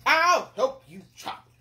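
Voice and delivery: loud, slow royal voice; hungry king. Acted. Hungry king offers to chop, booming voice. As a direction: boom it out deliberately.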